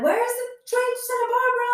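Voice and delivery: high, rushed mock-panic question; frantic asking. Late traveller running through the station, frantic question. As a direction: ask strangers in a high, fast rush.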